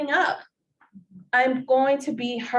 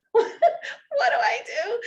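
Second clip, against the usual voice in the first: laughing mock panic; 10 semitones higher, slower than usual. Retelling panicked moment, laughing.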